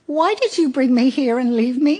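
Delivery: put-on a homesick kid asking why he was left; small child's voice. A narrator's reading.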